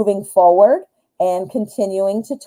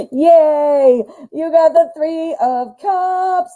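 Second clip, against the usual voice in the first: excited cheer; 8 semitones higher, much slower than usual. Real, not acted. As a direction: cheer for the viewer's card. Cheering the drawn card.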